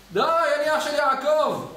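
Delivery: slow, high desperate yell; shouting. Retold or imitated. Esau yelling to the angels to stop, desperate. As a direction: plead at the top of his voice to make it stop. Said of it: "עד שהוא צועק".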